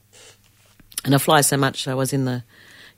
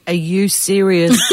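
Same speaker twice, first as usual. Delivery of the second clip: slow, louder mock outrage; indignant disbelief; 4 semitones higher, 5 dB louder, slower than usual. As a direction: drag out the question in loud disbelief. Retold indignant reply to whisperer.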